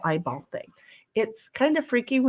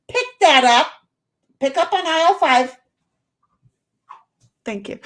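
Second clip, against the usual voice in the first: loud mock store announcement, louder and higher; store announcer call; 5 semitones higher, 6 dB louder than usual. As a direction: call it out like a supermarket PA calling for a cleanup. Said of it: "I had to give him an aisle five".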